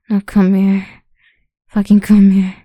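loud, harsh command; barked order. Acted. Captor dragging captive over, harsh loud commands. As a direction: bark it, then bark it harder.

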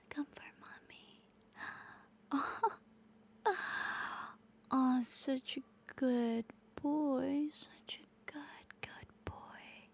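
slow, barely voiced praise; slow hushed praising whisper; very soft whisper. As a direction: whisper it slowly, almost silent. Intimate ASMR roleplay whisper.

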